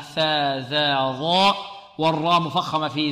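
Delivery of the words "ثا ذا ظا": ثا ذا ظا drawn out very slowly; letter drill. Shown as a demonstration. Teacher drilling the letters, very slow. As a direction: sound out ثا ذا ظا letter by letter. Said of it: "والذال معجمة".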